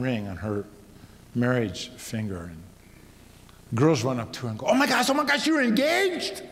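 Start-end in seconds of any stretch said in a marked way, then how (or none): 4.7-6.4 put-on high, loud squealing girl voice; excited gushing. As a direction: gush like a thrilled schoolgirl. Schoolgirls rush up excited.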